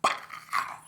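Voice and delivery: loud, explosive pow; imitated crack. Storyteller sounding pool stick across face, explosive pow. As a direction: burst out the noise of the blow.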